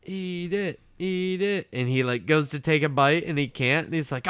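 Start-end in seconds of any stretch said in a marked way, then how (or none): 0.0-1.7 high-pitched goading chant; crowd egging on. Retold or imitated. Crowd urging Neptune to eat it, high goading. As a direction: imitate a heckling crowd in a high voice. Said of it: "then the crowd is like".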